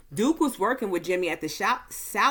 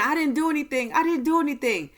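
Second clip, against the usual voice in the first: screaming; 8 semitones higher, faster than usual. Retold or imitated. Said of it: "Claire was screaming".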